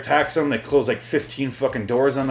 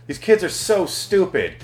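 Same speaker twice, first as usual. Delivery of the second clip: higher, drawn-out exasperated outburst; mock exasperated; 7 semitones higher, much slower than usual. Viewer scolds movie kids for answering parents, mock exasperation. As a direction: stretch the words in disbelief at their stupidity.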